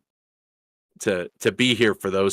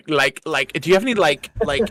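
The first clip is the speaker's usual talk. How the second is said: hammed-up Valley girl, higher and louder; 6 semitones higher, 4 dB louder than usual. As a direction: playfully overdo the Valley girl likes. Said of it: "I'm talking like a Valley girl now".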